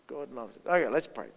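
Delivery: usual speaking voice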